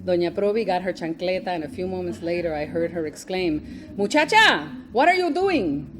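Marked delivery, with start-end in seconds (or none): 4.0-5.9 put-on high, loud scolding in a thick Spanish accent. Doña Provi catching Rosemary smoking, loud thick Spanish accent. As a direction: shout like a Puerto Rican grandmother catching a child in a thick accent. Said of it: "she said in her thick accent".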